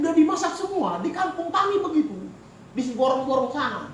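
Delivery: ordinary talk, nothing marked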